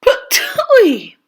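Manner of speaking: high, spluttering spit-out "Patooey!"; spitting patooey. A narrator's reading. Papa Bear spitting out scalding porridge, squealing spit. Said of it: "cried big old Papa Bear".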